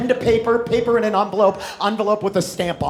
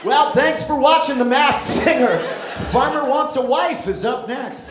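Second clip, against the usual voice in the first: host sign-off; 3 semitones higher, 5 dB louder than usual. Acted.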